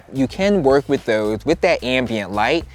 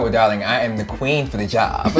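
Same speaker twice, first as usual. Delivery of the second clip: normal talking voice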